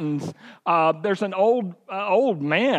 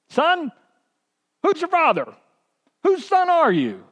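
much higher, louder voice of the big pastor; large pastor asking; 11 semitones higher, 4 dB louder than usual. Retold or imitated. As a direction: stop the boy with a searching question. Big pastor confronts boy.

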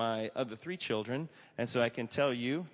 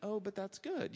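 higher and softer, lightly voiced wife coming round; a light touch of the wife making peace; wife recovering; 9 semitones higher, 4 dB quieter than usual. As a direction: gently talk herself into it. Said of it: "And then she was like".

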